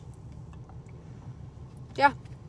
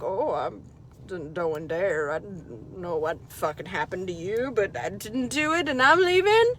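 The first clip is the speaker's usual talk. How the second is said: voicing the dog; 6 semitones lower, 6 dB quieter than usual. The dog denying it and sneaking off, low sheepish voice. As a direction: mumble it low like a guilty dog. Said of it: "he was like".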